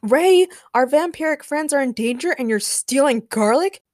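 high, loud disbelief; incredulous shout. A narrator's reading. James scolds Ray for stealing garlic amid danger, incredulous. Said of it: "James shouted incredulously".

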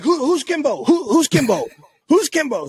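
high, puzzled mock voice; confused buddy. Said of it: "He's like".

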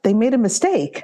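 a remembered mild double take; surprised thought. Retold or imitated. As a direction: recall thinking someone slipped up. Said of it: "my first thought at the time was".

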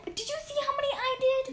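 high, fast and bubbly; excited. Real, not acted. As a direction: burst out proudly, pitch way up. Proud of her folding.

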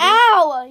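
loud, drawn-out ow; sudden loud cry of pain; pained yelp. Real, not acted. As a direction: yelp out in protest. Yelps during horseplay.

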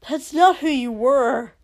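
high, loud, fierce denial; heated protest; angry insistence. Acted. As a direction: press the accusation harder. Hissed argument continues.

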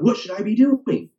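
high-pitched mock-curious passenger; voice imitation of passengers. Rideshare passenger asking a fitness coach for advice, high imitation voice. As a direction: imitate an eager asker, pitched well up. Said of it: "why I have the voice imitations".

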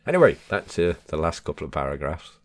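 usual speaking voice

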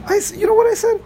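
high, excited outburst; high exclaim. Real, not acted. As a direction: voice jumps way up and out. Exclaiming at the Saw movie.

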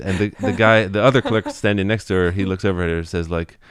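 ordinary talk

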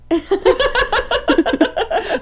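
squealing laugh after the bit; high-pitched giggle. Real, not acted. Laughs at own voice.

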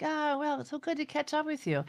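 high, hammed-up hearty greeting; a sing-song welcome for friends who stopped by; dad's visitor chat. Retelling how his dad greeted visitors, in a high hearty mock voice. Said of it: "And he's like".

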